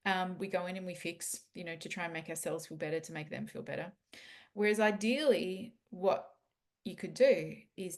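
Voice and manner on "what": ordinary talk, nothing marked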